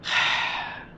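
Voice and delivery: long, voiceless exhale; exasperated sigh. Acted. Warrior is asked about the bells, exasperated sigh. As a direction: let out a big acted sigh of exasperation. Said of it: "She lets out an exacerbated sigh".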